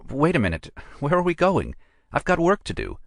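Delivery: Nora, protesting. A narrator's reading. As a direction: pull up short and object. Nora's protesting voice.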